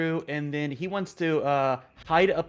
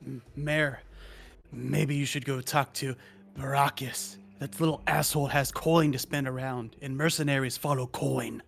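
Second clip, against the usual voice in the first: in-character advice. Acted. Player speaks in character.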